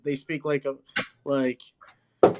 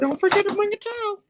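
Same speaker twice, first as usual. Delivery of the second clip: Towelie impression; 4 dB louder than usual. Quoting Towelie from South Park.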